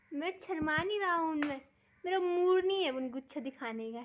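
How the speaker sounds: a lightly voiced sulky little one, a bit high and loud; voicing the little one. Mother speaking for Bulla, mock sulky reply. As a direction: answer for the baby, pretending to pout.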